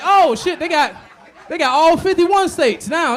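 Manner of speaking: loud, high shocked outburst, slowing on the last words; shocked shout. Retold or imitated. Comic retelling his shock at the red election map, loud and high. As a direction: yell it like you can't believe the map. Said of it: "I was like".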